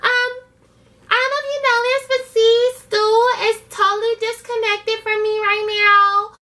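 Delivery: high, put-on sassy voice for Michelle's complaint. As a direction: mimic her high and indignant. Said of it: "And Michelle like".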